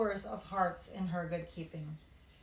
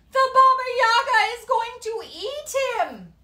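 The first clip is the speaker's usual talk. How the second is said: very high, loud terrified cry; panicked shout; 14 dB louder than usual. Acted. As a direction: scream a warning from the crowd. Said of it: "The voice from the crowd said".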